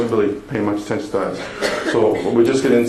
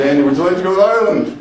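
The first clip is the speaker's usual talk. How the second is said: excited; 8 semitones higher, 5 dB louder than usual. Retold or imitated. Asking girlfriend to Ireland.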